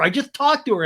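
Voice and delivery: high, loud mock protest; hammed-up defensive outburst; Sandoval. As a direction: imitate an insistent man shouting his excuse. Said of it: "And Sandoval's like".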